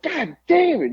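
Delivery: high caricature grumble; mock New Jack. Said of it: "He's like".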